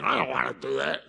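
low, soft, reluctant grumble; reluctant whine. Retold or imitated. Preacher mimics his own unwilling heart, low grumble. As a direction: grumble like you'd rather not.

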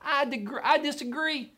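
high-pitched mock objector; defiant finite man. Finite man disagreeing with an all-powerful God, mock defiance. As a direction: puff up a silly, high-voiced dissent. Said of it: "a finite man with a finite brain".

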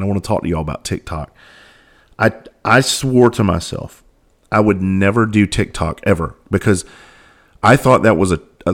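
ordinary talk, nothing marked

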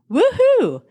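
high, loud whoop of joy; excited cheer. Real, not acted. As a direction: let out a joyful whoop. Cheering about abundance.

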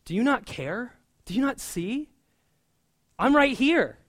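rising from pleading to an indignant cry; hurt protest. Retold or imitated. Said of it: "Has anyone else felt that at times?".

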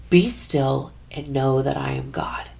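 breathy hushed whisper, no voice. Retold or imitated. Teresa recalls God's words coming to her, whispered. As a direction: whisper it like a thought arriving. Inner voice of God heard.

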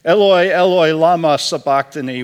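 a desperate call to God from the cross; loud cry. Acted. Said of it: "cried out in a loud voice".

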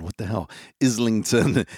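ordinary talk

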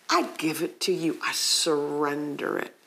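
soft, low acted prayer; quiet surrender. Doubter surrendering the doubt to God, quiet surrender. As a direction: let the voice drop as if letting go.

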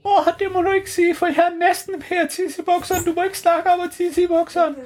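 a jittery little vampire begging them to stop; vampire pleading not to mention wetting himself, high and squeaky; Grev Bo's voice. Acted. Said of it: "Og så sagde Grev Bo".

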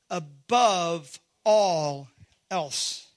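"above all else" slowly, word by word; weight on each word of "above all else", pausing before "else"; slow emphasis. Real, not acted. Preacher re-reading the verse to his congregation, slow emphasis.

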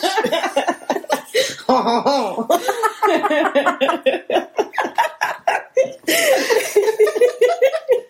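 high, long helpless giggle; can't stop giggling; long giggling fit. Real, not acted. Giggling fit at own slip.

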